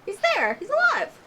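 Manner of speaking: high, rising, thrilled exclamations; excited. Real, not acted. Fan spotting a survivor on the TV, thrilled. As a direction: point at the screen, thrilled.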